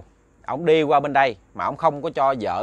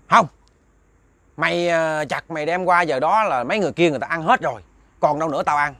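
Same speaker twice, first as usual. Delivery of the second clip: higher, louder rude ghost voice; the ghost snaps its refusal at the man, higher and louder; 5 semitones higher, 5 dB louder than usual. Retold or imitated. Ghost refusing the neighbour's offer, higher and louder.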